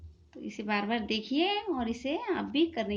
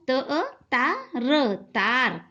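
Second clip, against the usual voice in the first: अ ता र said very slowly, then तार joined; slow blending of sounds; much slower than usual. Shown as a demonstration. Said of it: "उसको दो नुक्ते लगाए". (अक्षर धीरे-धीरे जोड़कर पढ़ना)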